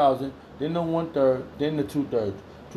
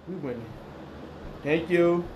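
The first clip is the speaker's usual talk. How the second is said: ordinary talk, nothing marked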